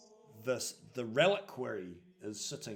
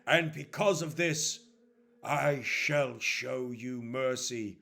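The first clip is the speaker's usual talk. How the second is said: slow, loud, weighty proclamation; stern priest passing judgement; 2 semitones higher, 6 dB louder, slower than usual. Acted. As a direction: hand down a sentence, building in force.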